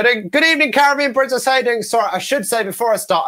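high, bright showman greeting; stage host opening. Retold or imitated. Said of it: "And I walk out and go".

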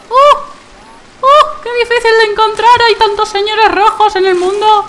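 high, fast squeaky exclamations; hammed-up excitement. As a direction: squeal it like a cartoon. (emoción exagerada y aguda)

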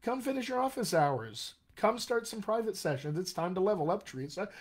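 eager, high-pitched coaxing; excited. Real, not acted. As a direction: cheer them on, voice lifted with excitement. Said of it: "I'm excited now".

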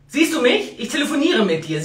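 ordinary talk

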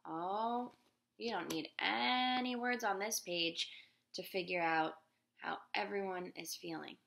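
plain reading voice

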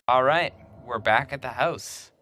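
normal talking voice

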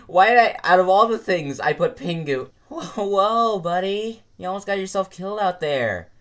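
usual speaking voice

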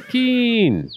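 high, bright shout of the name; happy call. Real, not acted. Player cheers as the horse arrives, high happy call. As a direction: greet her as she gallops up.

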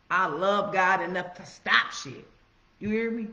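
ordinary delivery

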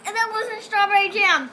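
loud, high, grossed out; eating gross jelly beans. Real, not acted. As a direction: complain with a foul taste. After tasting bad bean.

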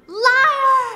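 high, loud outburst after a gasp; gasp, then shout the accusation. Real, not acted. Accuses friend over the cash.

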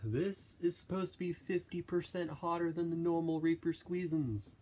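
excited. Real, not acted. Hyped hot sauce intro.

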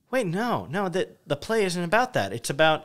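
high-pitched acted objection; protesting. Viewer objecting to cuts in the play, protesting.

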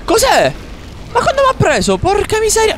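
high panicked yelps; high frightened outburst; panicked cries. Real, not acted. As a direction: shriek in alarm as you get grabbed. Grabbed by enemy.

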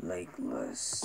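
low, soft monster whisper; Venom. Shown as a demonstration. Man echoing the film's threat, whispered monster impression. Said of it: "I got his voice down".

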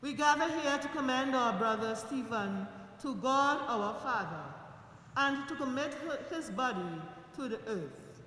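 loud, solemn liturgical voice; formal committal prayer. Real, not acted. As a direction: proclaim it to the mourners. Committal rite begins.

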